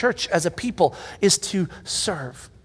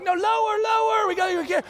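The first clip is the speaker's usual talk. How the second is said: directing shout. Retold or imitated. Directing kids to lower trampoline.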